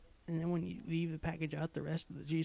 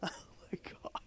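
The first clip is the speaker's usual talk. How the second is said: breathless whisper; 8 dB quieter, much faster than usual. Real, not acted. Whispered after laughing.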